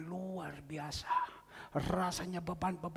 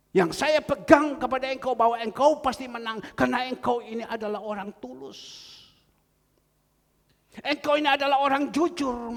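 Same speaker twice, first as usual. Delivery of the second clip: higher, louder sermon cadence; fiery preaching; 10 semitones higher, 13 dB louder than usual. Acted. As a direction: rouse the congregation with certainty. Preaching encouragement at full force. (berkhotbah dengan semangat dan suara lantang)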